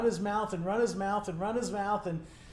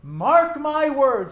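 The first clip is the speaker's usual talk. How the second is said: slow, loud, higher proclamation; declaration; 6 semitones higher, 9 dB louder, much slower than usual. Acted. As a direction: proclaim it slowly to the whole crowd. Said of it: "like a declaration".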